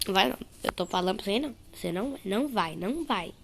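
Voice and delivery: normal talking voice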